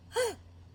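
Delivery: sudden startled intake of breath; quick acted gasp; sharp gasp. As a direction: gasp in surprise. Startled lion in a story.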